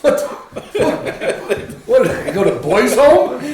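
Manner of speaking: high laughing dog voice; confused dog, laughing. Retold or imitated. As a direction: play the baffled pup, giggling. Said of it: "they're out here going".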